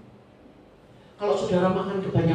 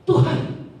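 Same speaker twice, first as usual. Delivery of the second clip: high-pitched, self-pitying complaint; whining Elijah; 11 semitones higher than usual. Acted. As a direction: plead to God like a worn-out prophet.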